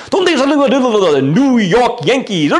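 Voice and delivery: high, excited mock-Spanish announcer; gabbled Spanish-accented commentator impression; Spanish radio sportscaster. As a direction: imitate Spanish sports radio, New York Yankees heavily accented. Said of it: "like, a Spanish station's on".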